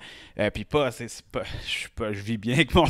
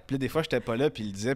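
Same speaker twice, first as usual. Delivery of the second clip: ordinary talk, nothing marked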